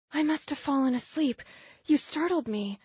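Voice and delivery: high, fast, shaken apology; flustered young woman. Acted. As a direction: rush it out, rattled.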